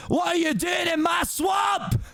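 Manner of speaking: high, slow Scottish bellow; aggressive Scottish Shrek. Acted. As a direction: bellow at intruders in a broad Scottish accent. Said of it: "my aggressive Scottish accent".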